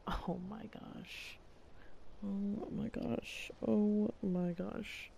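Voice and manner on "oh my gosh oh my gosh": normal talking voice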